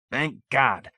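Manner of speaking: ordinary delivery, nothing marked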